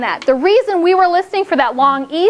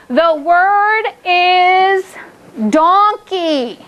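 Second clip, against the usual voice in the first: slow, stretched; much slower than usual. Shown as a demonstration. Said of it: "teacher dictating slowly".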